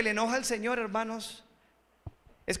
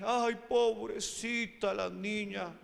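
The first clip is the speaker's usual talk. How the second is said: drawn-out, softer cooing of sympathy; mock pity; 6 dB quieter, slower than usual. Preacher mimics mourners following María to the tomb, mock pity. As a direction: pity her slowly, as a hammed-up mourner.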